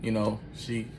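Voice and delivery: ordinary talk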